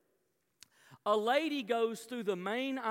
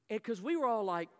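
ordinary talk, nothing marked

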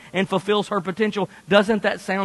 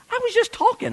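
high-pitched defensive husband; hammed-up defensiveness; mock protest; faster than usual. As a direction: protest your innocence in a high voice. Husband protesting in acted quarrel.

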